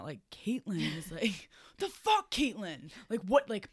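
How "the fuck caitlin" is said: high, loud opening burst; mock angry yell. Sleepwalker yelling at Caitlin in bed, mock angry yell. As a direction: imitate a friend yelling in anger. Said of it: "yelling at".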